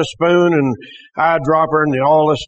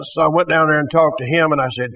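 usual speaking voice

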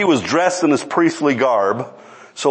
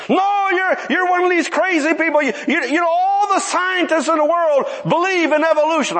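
high-pitched retold outburst; angry priest; 11 semitones higher than usual. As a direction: mimic the priest ranting, pitched way up.

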